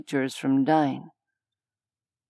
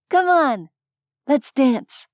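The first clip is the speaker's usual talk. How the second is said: high, louder eager female voice; playful invitation; 10 semitones higher, 4 dB louder than usual. A narrator's reading. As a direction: pull him onto the dance floor. Zora invites him to dance.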